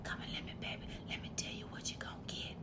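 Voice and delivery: soft unvoiced threatening whisper; menacing whisper. Dad whispering a threat to a crying baby, menacing whisper. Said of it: "This is not the whisper song".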